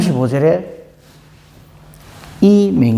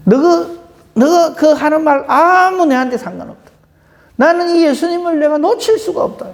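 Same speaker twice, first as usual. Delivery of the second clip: high, strong defiant cry; defiant shout; 10 semitones higher than usual. Retold or imitated. Blind beggar defying the crowd, crying out higher and harder. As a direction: refuse to be silenced. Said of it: "더 크게 소리를 지르는 거예요".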